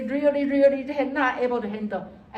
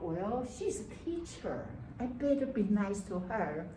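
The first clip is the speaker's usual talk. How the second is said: muttered inner thought; 7 dB quieter than usual. Retold or imitated. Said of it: "you think about".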